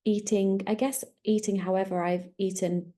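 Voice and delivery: ordinary talk, nothing marked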